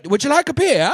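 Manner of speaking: high, loud mock Bavarian accent; German accent. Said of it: "they had that accent".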